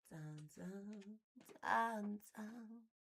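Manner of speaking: mock suspense sting; dramatic dun dun. Streamer making a dramatic sting sound. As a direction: intone it like a movie sting.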